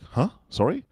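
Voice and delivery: high-pitched mock confusion; puzzled, questioning little yelp; puzzled query. Concierge not understanding a guest's name, puzzled.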